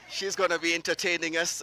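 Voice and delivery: ordinary talk, nothing marked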